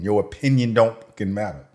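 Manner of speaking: ordinary talk, nothing marked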